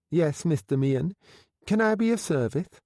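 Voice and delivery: lightly voiced young man with a slight lisp; lisping florist. A narrator's reading. Florist greeting his boss with a slight lisp. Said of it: "He spoke with a slight lisp".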